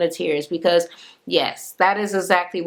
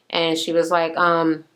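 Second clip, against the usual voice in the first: ordinary delivery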